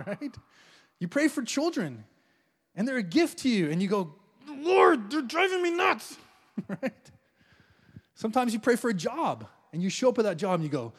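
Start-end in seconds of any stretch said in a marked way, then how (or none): 4.5-6.2 higher, strained mock complaint; frazzled parent. Parent complaining to the Lord about the kids, mock-frazzled. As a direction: whine like a worn-out parent.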